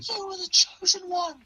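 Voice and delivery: high, strained anguished cry; anguished Obi-Wan. Retold or imitated. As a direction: wail it out in heartbreak. Quoting Obi-Wan's climactic scream.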